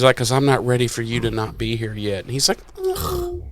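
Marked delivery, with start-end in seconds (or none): 0.2-2.2 quick, soft and low mock plea; soft Buffy line. Buffy telling Spike to stay, soft and quick. 2.8-3.4 soft, high strained ugh of frustration; high frustrated groan. Retold or imitated. Spike groaning at Buffy's teasing, high and soft.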